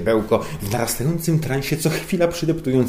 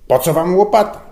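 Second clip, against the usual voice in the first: loud, much higher exasperated snap; annoyed; 11 semitones higher, 11 dB louder than usual. A narrator's reading. Boss annoyed at his underling, loud snap. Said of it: "wkurzył się Żbik".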